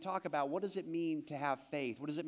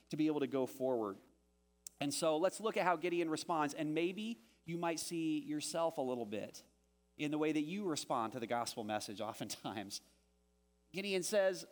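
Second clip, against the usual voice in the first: ordinary talk, nothing marked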